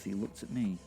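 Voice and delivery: ordinary delivery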